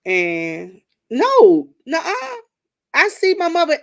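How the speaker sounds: indignant nuh-uh; indignant denial. Real, not acted. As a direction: set the audience straight. Protests to the audience.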